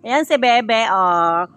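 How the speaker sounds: ordinary talk, nothing marked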